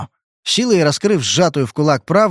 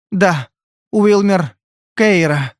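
high, halting answer; drugged girl; 7 semitones higher, much slower than usual. A narrator's reading. Drugged girl naming who took Bridget, halting. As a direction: strain out each name separately.

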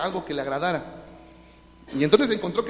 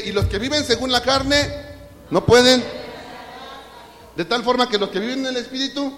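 pulpit scripture proclamation; fiery scripture reading; 9 semitones higher, 5 dB louder than usual. Acted. Reads scripture at full force.

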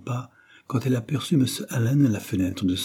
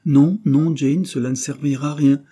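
higher, louder insistent protest; emphatic refusal; 6 semitones higher, 7 dB louder than usual. A narrator's reading. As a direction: wave the idea away firmly. Husband refuses wife's company.

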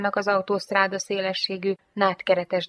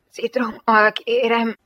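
slow, groaned plea, higher; groaning; 5 semitones higher, 4 dB louder, slower than usual.

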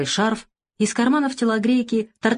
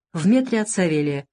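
plain reading voice

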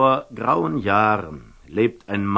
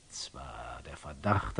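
"zwar" spoken softly, voiced, rising; a quiet voiced "zwar" with a questioning lift; a soft, doubtful rising "zwar"; hesitant; 6 semitones lower, 11 dB quieter than usual. Hesitant aside, word repeated.

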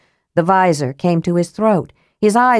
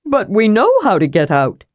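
a slightly different voice, protesting; a lightly voiced kender arguing; 3 semitones higher than usual. A narrator's reading. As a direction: push back like a stubborn kender. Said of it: "Tass argued".

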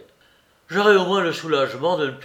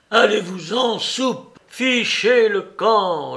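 bien plus fort et plus aigu, exaspéré; chasse-le avec rage; colère furieuse; 4 semitones higher, 4 dB louder than usual. Acted. Larrier furieux chasse Soupe. (Larrier chasse Soupe avec fureur)

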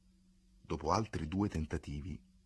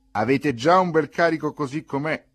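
loud, higher-pitched onlooker's jibe; bystander's loud remark; 10 semitones higher, 14 dB louder than usual. A narrator's reading. Bystander to the newcomers about their load, loud jibe. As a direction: call it out loudly at the newcomers. Bystander jeers at overloaded sled.

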